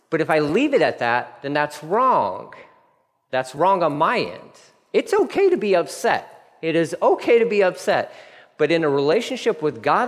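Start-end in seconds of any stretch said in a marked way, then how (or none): none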